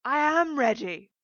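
high, very loud indignant reply; thick, nasal shout. A narrator's reading. Tearful mother answers daughter through door, thick shout. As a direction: shout back through a blocked nose. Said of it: "she shouted back, her voice thick and nasal".

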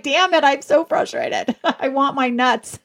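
laughing through a hammed-up grumble; jokey self-pitying complaint, half laughing; mock frustration. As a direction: play up your own frustration while giggling. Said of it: "I'm just like".

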